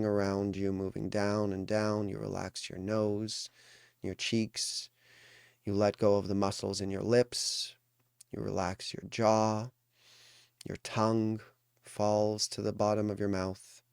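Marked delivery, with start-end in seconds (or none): none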